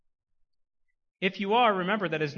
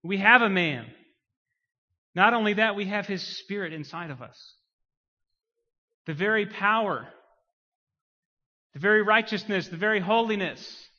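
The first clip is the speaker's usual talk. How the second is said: loud, high, exultant proclamation; full-force preaching; 5 semitones higher, 7 dB louder than usual. Real, not acted. Preacher proclaiming the Spirit within us, impassioned preaching. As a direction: drive each phrase out to the congregation.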